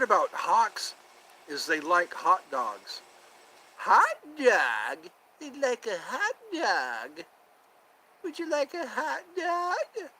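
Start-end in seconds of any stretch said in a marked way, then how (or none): 3.8-10.1 high, squeaky sketch voice offering a hot dog; Emma Stone hot dog skit. Retold or imitated. Streamer imitating the Emma Stone SNL skit, high and squeaky. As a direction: pitch way up and drawn out, like the SNL bit. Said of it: "Wasn't that Emma Stone?".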